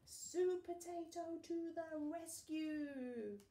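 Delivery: high, slow triumphant fanfare voice; heroic announcement. A narrator's reading. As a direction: announce the hero like a trailer. Hero announcement.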